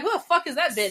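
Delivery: high, loud, offended yell; mock inmate outburst; indignant inmate. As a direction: snap it like an outraged inmate. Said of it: "Then they're gonna be like".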